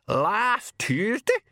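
very high, slow Scots pixie; tiny pixie voice. Acted. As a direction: squeak it like a wee man. The tiny Feegle speaks.

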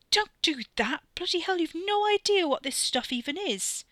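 high, loud outburst; alarmed scolding. Acted. Man stopping his companion tasting a sticky membrane, alarmed scolding.

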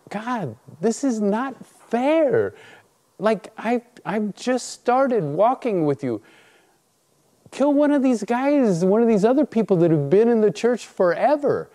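high-pitched, indignant plea to God; pleading complaint. Retold or imitated. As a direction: protest the unfairness in a high, whiny plea. Acted panicked complaint to God.

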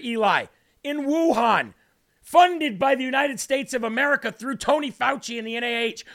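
ordinary talk

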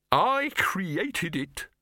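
high, very drawn-out doleful voice; morose Silverfish. A narrator's reading. Silverfish lamenting what he created, high and morose. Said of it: "said Silverfish morosely".

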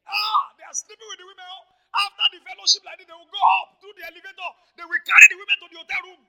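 high, shouted mock accusation; scandalized gossip. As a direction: imitate outraged accusers at full volume. Acting out the hostile gossipers.

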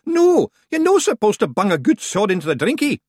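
high, loud broad Scots telling-off; loud Scots scolding. Acted. Rob scolding Roland about the sword, loud. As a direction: give the lad a ticking-off.

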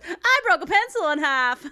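loud, high mock exclamation; imitated giddy shout; hyper quoted outburst. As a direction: blurt it like a hyper boy band kid. Quoting hyper singer's funny outburst.

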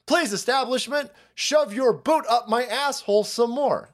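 high, sarcastic fawning; hammed-up bootlicker's adoration; mock gushing. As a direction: gush with over-the-top devotion. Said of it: "all of these people are like".

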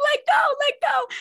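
high, urgent yelled command; urgent shout. Retold or imitated. Owner ordering the dog to drop the rabbit, high urgent shout.

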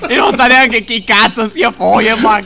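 Cacciari impression. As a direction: rant like an outraged TV philosopher. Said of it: "l'imitazione di Cacciari".

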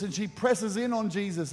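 ordinary talk

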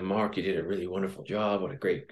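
soft imitated British accent. Conference attendee praising a speaker after his talk, imitated British accent. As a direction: quietly quote him in a light British accent. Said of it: "got this British accent".

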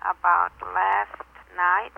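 slow, soft, writing it down; repeating while writing. Acted. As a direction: murmur it back as you write.

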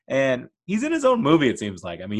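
ordinary talk, nothing marked

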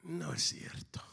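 soft, low preacher storytelling; hushed testimony. Acted. Preacher telling a father his God can heal his daughter, hushed testimony. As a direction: draw the congregation in quietly with pauses.

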